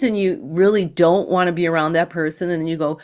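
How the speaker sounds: ordinary delivery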